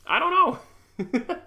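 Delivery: high, slow laugh through the words. Real, not acted. Laughing about the manager's call, amused disbelief. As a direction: giggle it out in disbelief.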